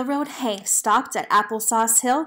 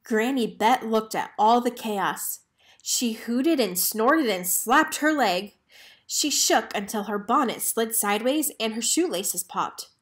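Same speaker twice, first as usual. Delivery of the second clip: plain reading voice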